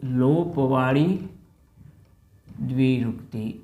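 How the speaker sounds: "લોપવાળી" drawn out slowly, then "દ્વિરૂક્તિ" set apart; slow dictation. Shown as a demonstration. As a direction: say each word slowly, as if the class is writing it down. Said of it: "અહીંયા લખી દઉં છું". (ધીમે ધીમે લખાવતા હોય એમ બોલો)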